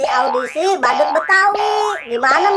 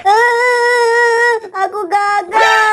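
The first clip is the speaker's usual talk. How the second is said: slow tearful voice, pitched up; crying; 6 semitones higher, much slower than usual. Acted. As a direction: choke out the bad news. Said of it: "Kok tiba-tiba kamu nangis?". (menangis tersedu)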